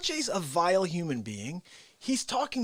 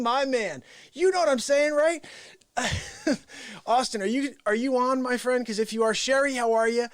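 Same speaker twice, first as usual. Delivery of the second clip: ordinary delivery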